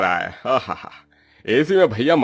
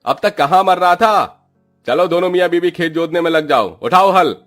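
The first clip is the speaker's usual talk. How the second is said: loud, high bullying outbursts; hammed-up irate boss; angry landlord's scolding; 6 semitones higher, 5 dB louder than usual. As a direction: berate the labourers, building to shouted orders. Landlord berates late labourers.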